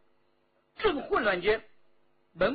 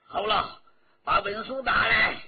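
loud commanding officer; impatient officer repeating his demand; officer's demand; 4 semitones higher, 7 dB louder than usual. A narrator's reading. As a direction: order it sharply. Officer demands the document again.

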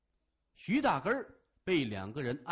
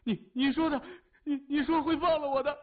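much higher, louder frantic protest; panicked stammer; 12 semitones higher, 6 dB louder than usual. A narrator's reading. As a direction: blurt it out in panic. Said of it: "惊慌地看着谢文东".

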